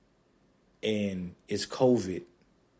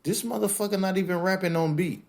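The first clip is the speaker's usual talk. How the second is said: outraged disbelief; 9 semitones higher than usual. Retold or imitated. Fan complaining the rapper is off beat, higher indignant voice. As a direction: protest like a betrayed fan. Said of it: "I'm like".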